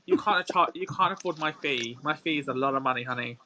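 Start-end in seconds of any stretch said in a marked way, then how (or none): none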